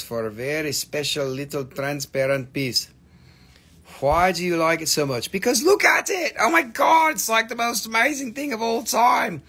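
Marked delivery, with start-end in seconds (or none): 5.3-9.4 excited gushing. Real, not acted. Answering why she loves the stone, much higher and excited. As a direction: burst out with real delight.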